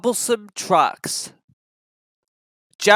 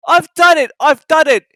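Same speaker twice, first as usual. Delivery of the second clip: much higher, loud cheer; triumphant; 11 semitones higher, 10 dB louder than usual. Acted. James reaching the top, triumphant and loud. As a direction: crow it in victory. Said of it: "he puffed triumphantly".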